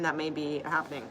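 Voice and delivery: ordinary talk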